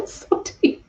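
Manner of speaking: breathy whispered so deep; awed whisper. Real, not acted. Medium telling the sitter how deep it was, in a whisper. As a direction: whisper it in awe.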